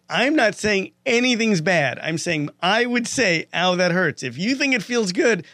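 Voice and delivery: retold argument getting heated and loud; heated protest. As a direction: push back hard, voice up high. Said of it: "And I said".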